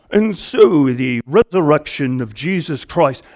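lightly voiced English bishop, solemn; mock posh English preacher, only lightly put on; English accent. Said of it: "throw the English accent on it".

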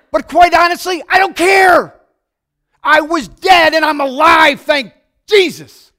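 high, loud, slow proclamation; preaching shout. Real, not acted. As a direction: shout it out in joy. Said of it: "preacher's own shout".

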